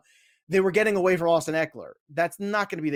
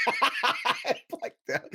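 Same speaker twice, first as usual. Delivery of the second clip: laughing; 10 semitones higher, 3 dB quieter, much slower than usual. Pretend. Echoing partner mockingly, laughing.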